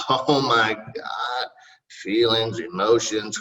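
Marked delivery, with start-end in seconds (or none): none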